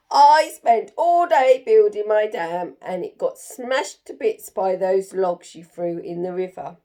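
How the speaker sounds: loud, furious grumble; snarling beaver. Acted. Beaver snarls at Jim about its smashed dam, loud angry. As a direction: snarl the complaint loudly. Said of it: "it snarled".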